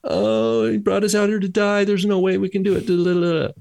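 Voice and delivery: hammed-up moaning, held high and trailing into nonsense syllables; mock whining. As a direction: imitate hopeless complainers in a high monotone whine. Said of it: "And what are the other ten?".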